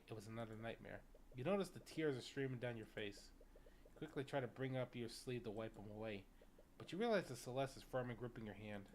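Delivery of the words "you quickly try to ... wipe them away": ordinary delivery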